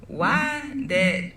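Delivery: ordinary talk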